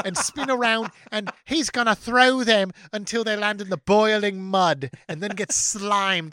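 hammed-up kids' TV host announcing rules; game show host voice. Host explaining the kid-throwing event in a mild put-on accent. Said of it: "I don't know what that accent was".

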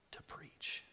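hushed ending; whisper. Acted. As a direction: let the word fade to breath. Quote trails into hush.

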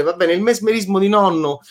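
usual speaking voice

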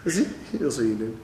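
low, soft, gentle reply; humble and soft. Acted. Hillel answers the man meekly, low and soft.

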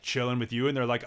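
normal talking voice

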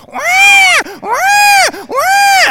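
very high, loud mock baby cry; screeched impression of a wailing newborn; imitated newborn wail. Dad mimics his newborn girl, piercing wail. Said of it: "as soon as they're saying the word girl, I hear".

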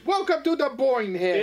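put-on high, hammy Russian accent; goofy Russian accent; bad Russian accent. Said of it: "bad Russian accent".

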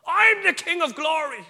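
high, loud demonic boast; devilish voice. Retold or imitated. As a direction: shout it out like a taunting devil. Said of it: "it was devilish in tone".

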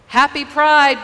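loud, high call across a crowd; cheerful parade yell; yelled greeting. Retold or imitated. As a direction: shout it out to the parade watchers. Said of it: "we yelled".

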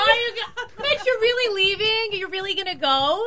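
high-pitched mock pleading; mock baby-talk teasing. Co-hosts to Mitch walking out, high mock pleading. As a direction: tease him in a high, babying voice.

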